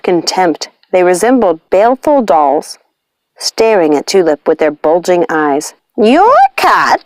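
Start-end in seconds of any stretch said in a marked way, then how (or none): 6.0-7.0 high, incredulous witch question; high mock-incredulous witch; menacing witch. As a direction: ask it with a deadly look. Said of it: "asked Lucinda, giving Tulip a deadly look".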